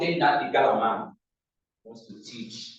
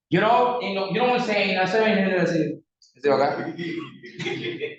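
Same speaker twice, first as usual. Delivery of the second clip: mock British affectation, higher and louder; put-on British accent; 4 semitones higher, 4 dB louder than usual. Mocking a neighbour back from abroad, put-on British accent. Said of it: "want to behave like a British".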